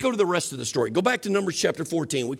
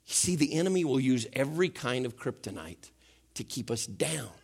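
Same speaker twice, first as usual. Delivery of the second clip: usual speaking voice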